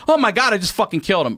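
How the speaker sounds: high, fast mock panic; hammed-up panicked realization; retold panic. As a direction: blurt it out high in comic horror. Retold panic after cousin falls.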